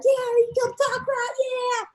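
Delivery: squeaky, high goblin cheer; goblin crowd voice. Acted. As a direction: chirp it like a cheering goblin mob. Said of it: "all the goblins are like".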